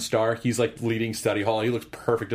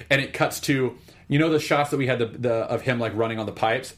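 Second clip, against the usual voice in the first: ordinary talk, nothing marked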